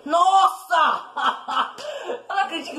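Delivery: high laughing disbelief. Real, not acted. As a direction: laugh it out, can't believe it. Laughs at own death.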